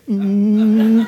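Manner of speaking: long, high strained "mm"; drawn-out mock-stern hum; emphatic grunt. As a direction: hold a tight high hum of warning. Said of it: "And emphatic face".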